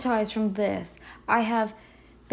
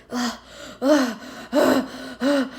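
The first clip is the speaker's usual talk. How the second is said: imitated worn-out runner's groans; mock post-run gasping; exhausted groaning pants; slower than usual. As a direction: heave out short, spent groans. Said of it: "you come back and you're shaking".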